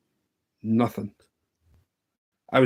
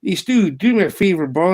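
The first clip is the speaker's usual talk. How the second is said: put-on Southern US accent, pitched up; Southern boy accent; 7 semitones higher, 6 dB louder than usual. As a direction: imitate a Southern buddy asking a favour. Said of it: "pure Southern boy accent".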